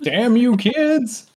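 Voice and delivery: put-on high-pitched cranky old man cry; Abe Simpson. Cursing kids like a cartoon old man, high crotchety impression. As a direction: shake a fist at the youngsters in a high old voice. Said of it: "kind of an Abe Simpson".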